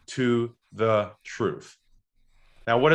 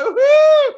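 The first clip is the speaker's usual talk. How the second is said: mock whoop of joy; 12 dB louder than usual. Mock-celebrating a sales call.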